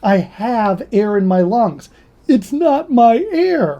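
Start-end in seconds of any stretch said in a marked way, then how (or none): 2.3-3.8 emphatic high. Real, not acted. Emphatic point about breath.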